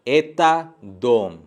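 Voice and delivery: slow, careful Russian sentence; slow, careful demo for Hindi learners; slow teaching demo. Shown as a demonstration. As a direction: spell out एता दोम slowly for learners. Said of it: "पहला sentence है".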